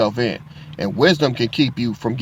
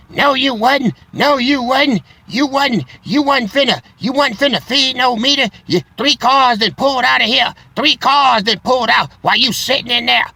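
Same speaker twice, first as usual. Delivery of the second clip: much higher, louder shrill-free mock scolding; angry officer voice; 13 semitones higher, 4 dB louder than usual. Parking officer yells he wasn't going to pay, mock furious shout. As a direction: play the furious meter maid, loud and high. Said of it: "She was hot".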